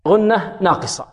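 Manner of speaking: ordinary delivery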